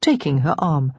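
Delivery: usual speaking voice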